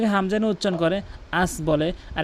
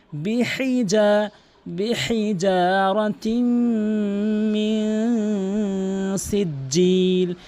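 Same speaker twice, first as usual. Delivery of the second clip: slow, drawn-out melodic tajweed chant; Quran recitation; 3 semitones higher, much slower than usual. Shown as a demonstration. Teacher reciting the verse for students, slow melodic chant. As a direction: chant each word long and measured.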